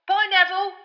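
called farewell. A narrator's reading. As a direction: call out after him. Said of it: "called Stan".

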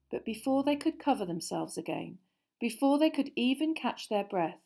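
usual speaking voice

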